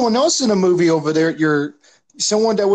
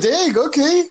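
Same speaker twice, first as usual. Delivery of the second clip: surprised; 9 semitones higher, slower than usual. Retold or imitated. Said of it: "I was like".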